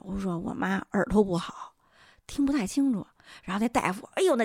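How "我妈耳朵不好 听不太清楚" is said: ordinary delivery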